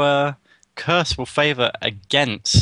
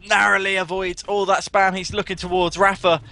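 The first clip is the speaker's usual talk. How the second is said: higher-pitched running match commentary; tense play-by-play; 5 semitones higher than usual. Real, not acted. Commentator follows Clock dodging and jumping, tense high play-by-play. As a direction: follow each move with mounting tension.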